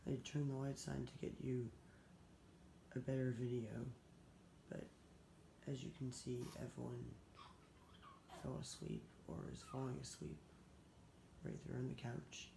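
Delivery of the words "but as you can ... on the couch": whispering over a crib. Real, not acted. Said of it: "be very quiet".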